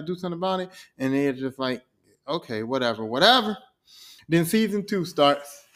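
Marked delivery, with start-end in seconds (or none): 2.3-3.0 dismissive. Acted. TVA agents brush Loki off, low and dismissive. 3.0-3.6 louder, sharper whatever; annoyed brush-off. Acted. TVA agents dismiss Loki again, louder and annoyed.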